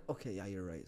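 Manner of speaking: low, soft concession; subdued retold agreement; calmed down. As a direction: back down quietly, imitating her. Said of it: "she starts talking normally".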